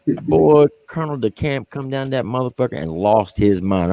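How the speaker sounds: usual speaking voice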